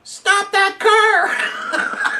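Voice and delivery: high, slow mock yell with a laugh; laughing mock shout of a bystander; retold scream. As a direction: play the distant guy yelling, laughing through it. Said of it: "there's some guy who screams".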